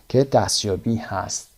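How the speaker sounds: ordinary delivery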